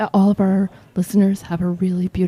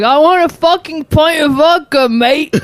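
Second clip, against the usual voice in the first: higher-pitched hammed-up British voice; put-on British accent; 6 semitones higher than usual. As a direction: play a brash fake Brit. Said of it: "I decided a British accent would make them serve me".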